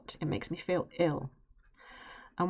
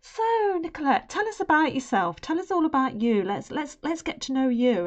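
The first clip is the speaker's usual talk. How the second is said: high, bright cheerful interviewer voice; chirpy and much higher, welcoming her in; jolly interviewers; 9 semitones higher, 5 dB louder than usual. Retold or imitated. Interviewers cheerfully greeting the nervous candidate, high and jolly. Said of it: "all jolly and smiley and saying to me".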